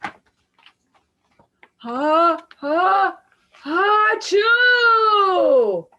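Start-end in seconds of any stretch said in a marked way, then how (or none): 1.8-5.9 building sneeze, much higher and louder at the end; big sneeze. Acted. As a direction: wind up and explode into a huge sneeze. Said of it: "He blows and he sneezes".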